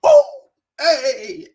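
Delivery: high-pitched celebratory "oh, hey!"; silly high hype cry; playful whoop. Pretend. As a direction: whoop it way up high after the chant. Whooping after the opening chant.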